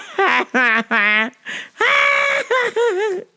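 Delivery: high screaming laughter; shrieking laugh. Real, not acted. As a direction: howl with laughter. Everyone busting up.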